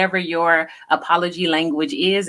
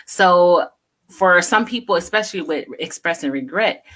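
ordinary talk

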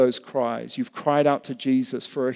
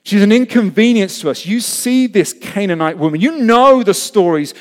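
loud, raised insistent complaint; annoyed complaint; 6 semitones higher, 8 dB louder than usual. Acted. Disciples complain to Jesus about the woman, loud annoyed protest. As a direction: press the grievance forcefully.

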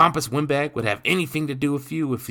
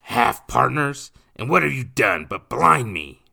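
menacing; 8 semitones higher, 9 dB louder than usual. Acted. Nathan to William, menacing. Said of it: "Nathan menaced".

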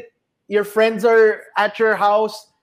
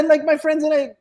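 ordinary talk

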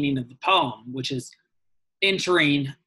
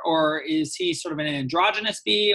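ordinary talk, nothing marked

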